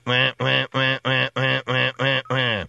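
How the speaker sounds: repeated wah cries; mock animal wailing; imitated animal wah. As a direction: copy the creature's call. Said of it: "I'm hearing".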